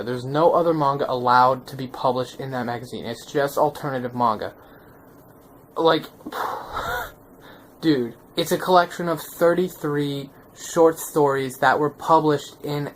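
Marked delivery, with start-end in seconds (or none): 6.1-7.1 long breathy puff of air; heavy exhale of amazement; awed exhale. Real, not acted. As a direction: blow out air, mind blown. Blown away by the book.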